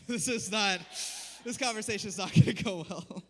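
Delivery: loud, laughing mock panic; loud laughing dread. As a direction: blurt it out in a rising panic. Panicked aside at end of story.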